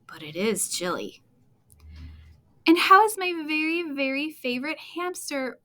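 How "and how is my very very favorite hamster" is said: higher and louder, cooing at a pet; cooing teacher. Acted. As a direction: gush over the hamster like a fond teacher. Teacher greets the hamster.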